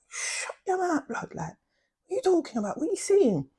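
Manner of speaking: mock scolding. Friends scolding the shouter.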